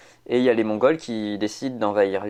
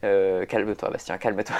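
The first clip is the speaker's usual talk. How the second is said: laughing at himself. Real, not acted.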